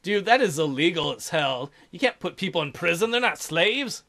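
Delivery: ordinary talk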